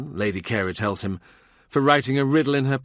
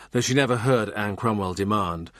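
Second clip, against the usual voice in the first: usual speaking voice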